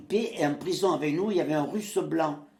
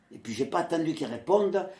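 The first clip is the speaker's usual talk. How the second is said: ordinary talk, nothing marked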